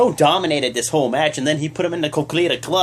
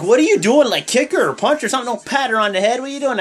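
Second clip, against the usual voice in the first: louder, higher, baffled what-are-you-doing; incredulous rant; 7 semitones higher, 3 dB louder, much faster than usual. Retold or imitated. Fan scolding Dana Brooke for patting Asuka, exasperated. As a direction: disbelief at a wrestler patting her opponent. Mocking Dana's head pat.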